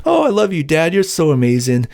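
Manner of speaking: put-on high-pitched gushing kid, easing down; mock adoring child. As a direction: play a child gushing over Dad, mockingly. Imagined child praising firing dad.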